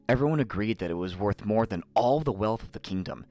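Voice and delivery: plain reading voice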